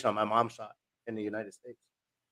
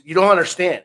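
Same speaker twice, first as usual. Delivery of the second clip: raised, forceful retelling of a stern order; a big man putting his foot down, louder; firm retold outburst; 6 semitones higher, 9 dB louder than usual. Don refusing the Army recruiters, forceful raised voice.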